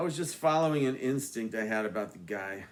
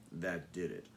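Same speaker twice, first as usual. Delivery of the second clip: ordinary delivery, nothing marked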